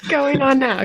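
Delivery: car ad voice. Pretend.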